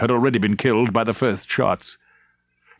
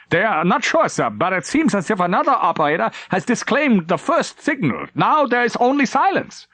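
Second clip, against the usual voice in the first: raised, hesitant report; nervous report; 11 semitones higher than usual. Acted. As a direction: push the bad news out anxiously.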